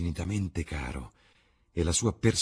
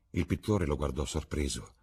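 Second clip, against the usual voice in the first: ordinary delivery, nothing marked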